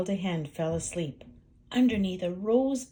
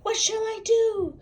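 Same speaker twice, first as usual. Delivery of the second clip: panicked hen; 6 dB louder than usual. Acted.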